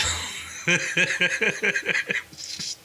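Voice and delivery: breathy real laugh, voice gone to air. Real, not acted. Host laughing about the stranger's farm bill idea, real breathy laugh. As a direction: laugh it off, amused.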